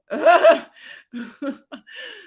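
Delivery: high chuckle that trails up; real, high laugh to herself. Real, not acted. Vlogger reading a viewer's comment, amused laugh.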